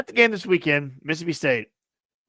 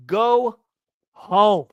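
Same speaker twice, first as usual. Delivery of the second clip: louder barked order to leave; angry yell; 4 semitones higher, 6 dB louder than usual. Retold or imitated. Podcaster ranting at a rude fan, loud angry order. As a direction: tell him off, loud and final. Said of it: "let me go on a little rant here".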